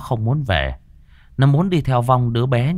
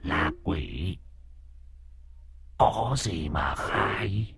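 ghost voice; 2 semitones lower, 10 dB quieter, much slower than usual. Acted. The ghost in Xuân taunts the master, hushed and slow. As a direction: let the ghost speak softly and slowly through her. Possessed girl answers as ghost.

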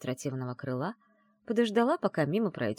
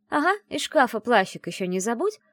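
high, louder mock advice; sarcastic snort; 8 semitones higher, 6 dB louder than usual. A narrator's reading. Friend mocking heroine's excuse to go, sarcastic snort. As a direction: scoff while pretending to help. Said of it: "фыркнула она".

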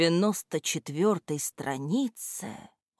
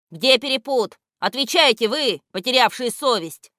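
higher, louder angry demand; 6 semitones higher, 4 dB louder than usual. A narrator's reading. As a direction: scold them for an answer. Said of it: "продолжал сердиться".

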